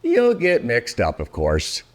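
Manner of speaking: plain reading voice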